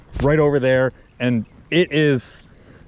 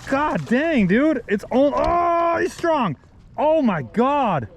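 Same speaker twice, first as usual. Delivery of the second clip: high, thrilled exclamations; real excitement as the fish pulls hard; excited; 7 semitones higher than usual. Real, not acted. Angler fighting a strong fish, real high-pitched excitement.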